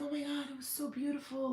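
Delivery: high excited squeal of joy; thrilled gush. Retold or imitated. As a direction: gush like it's the best moment ever. Retelling her own thrilled reaction.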